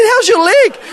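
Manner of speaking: high, loud, excited question; eager question. Retold or imitated. Preacher checking on the woman he prayed for, excited. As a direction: act out an eager check, voice leaping up.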